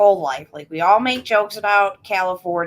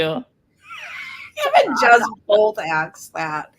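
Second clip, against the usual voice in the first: laughing; much slower than usual. Real, not acted. Laughing at the accent request.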